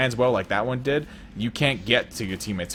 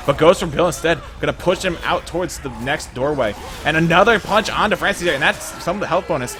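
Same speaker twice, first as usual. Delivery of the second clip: fast, loud, high esports casting; hyped play-by-play; 5 semitones higher, 6 dB louder than usual. Real, not acted. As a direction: call the action at full hype. Excited live play-by-play.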